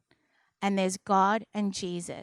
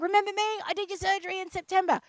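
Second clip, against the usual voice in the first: high, quick, eager chatter; bubbling over, pitched well up; pumped-up anesthesiologist; 10 semitones higher, much faster than usual. Retold or imitated. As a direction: imitate a doctor bursting with enthusiasm. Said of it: "He was so pumped".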